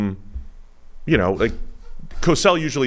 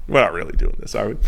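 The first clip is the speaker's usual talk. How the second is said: ordinary delivery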